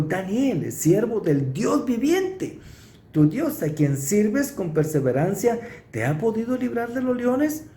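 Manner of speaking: king calling anxiously into the den at dawn; anguished call. Acted. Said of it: "con voz angustiada".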